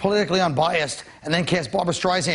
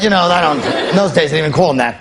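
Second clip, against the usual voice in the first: ordinary talk, nothing marked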